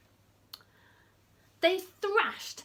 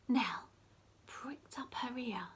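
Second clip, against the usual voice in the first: whisper; 7 dB quieter than usual. Acted.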